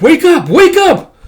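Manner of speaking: very high, loud cries; frantic shout. Acted. Character trying to rouse knocked-out companions, frantic shouting. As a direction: yell to rouse the unconscious. Rousing unconscious friends.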